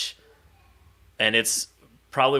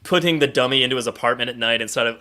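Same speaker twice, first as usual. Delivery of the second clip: ordinary talk, nothing marked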